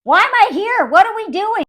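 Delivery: high-pitched mock bewilderment; mock confusion. Confused woman questioning the man, high mock distress. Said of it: "She's like".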